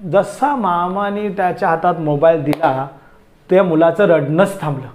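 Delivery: normal talking voice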